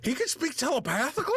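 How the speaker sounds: high, incredulous question; astonished question. Acted. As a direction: react to startling news, pitch jumping up. Character learns of secret telepathy.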